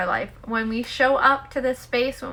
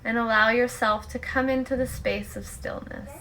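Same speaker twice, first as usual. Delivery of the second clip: guided meditation; 7 dB quieter than usual. Acted. Host guiding listeners to let go and just be, guided meditation. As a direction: lead them gently into stillness, leaving long pauses.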